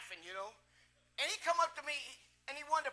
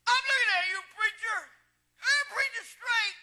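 loud, high, thick-tongued imitation; mimicked heckling call, high and drawn out; Virgil's voice; 9 semitones higher, 7 dB louder than usual. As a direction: holler it up at the pulpit. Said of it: "he'd always say before I got up to preach".

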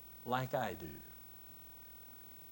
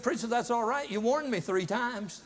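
lady's dry reply; 10 semitones higher, 5 dB louder than usual. Retold or imitated. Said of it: "And she said".

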